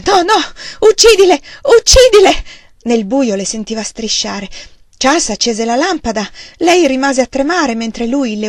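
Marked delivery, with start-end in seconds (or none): 0.0-2.7 high, loud frantic shriek; hysterical screaming. A narrator's reading. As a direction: scream at him to kill them. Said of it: "Lei gridava". (urlo isterico e disperato)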